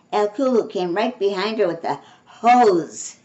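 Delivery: ordinary delivery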